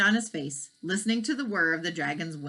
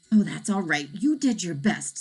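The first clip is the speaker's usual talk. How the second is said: ordinary delivery, nothing marked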